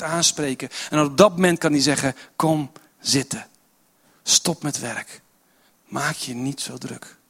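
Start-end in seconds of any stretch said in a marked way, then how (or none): 2.4-7.2 gentle invitation. Acted. Jesus speaks to the heart.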